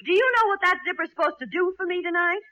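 high, exasperated rebuke; worked-up, irate demand; indignant scolding. Acted. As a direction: cut him off sharply, then rush on. Cuts off his stammered objection.

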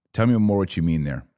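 ordinary talk

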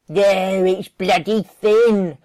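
loud, slow grumble; grumbling Joy. Acted. Joy grumbling to Dillard about the thin cow. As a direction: grumble it out slowly and loudly. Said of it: "Joy grumbled".

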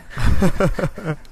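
bright burst of laughter; high laugh. Real, not acted. Laughs at the joke.

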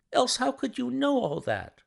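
high, amazed question; startled cry. A narrator's reading. Mary asking Holmes how he knew, in a high amazed voice. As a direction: she can't believe he knew. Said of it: "she cried".

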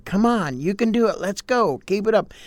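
rally someone like a coach; cheering on. Retold or imitated.

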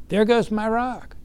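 mock dismay. Man watching his rock sink, mock dismay. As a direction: wail it as the rock slips away.

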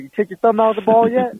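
high, cocky greeting with swagger; swaggering buddy. Retold or imitated. Imitating a friend's swaggering hello, pitched high. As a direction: strut into the words like a cool friend. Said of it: "have his little swagger that he has".

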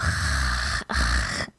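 drawn-out gargled rasp; throat-clearing French R. Shown as a demonstration. Teacher showing students the gargling exercise, throaty rasp. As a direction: gargle a long R at the back of the throat. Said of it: "so go".

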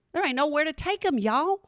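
usual speaking voice

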